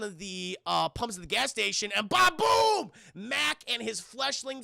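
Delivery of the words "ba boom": loud, high mouth explosion sound; explosion noise. Retold or imitated. Narrator imitates the gas station blowing up, loud boom. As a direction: blow it up with your voice.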